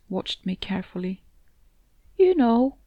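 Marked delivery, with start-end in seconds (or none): 2.2-2.8 tentative opener, lightly held back; hesitant. A narrator's reading. Cat gently broaching an idea to his upset companion, hesitantly. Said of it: "he said hesitantly".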